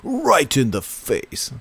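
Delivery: mock hero line. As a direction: savour the line slowly. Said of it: "It's so great".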